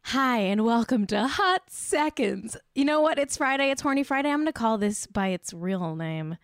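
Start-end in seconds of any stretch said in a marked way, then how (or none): 0.1-4.3 mock show host. Opening her own show segment.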